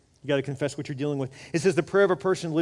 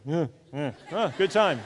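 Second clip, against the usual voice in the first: chuckling guy talk; 2 semitones higher, 4 dB louder, slower than usual. Retold or imitated. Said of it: "They just grunt at each other".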